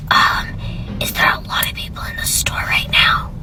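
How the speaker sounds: faked raspy whisper, barely voiced; mock sore-throat whisper. Caller faking a sore throat to the cashier, whispered. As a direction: whisper like you can't use your voice.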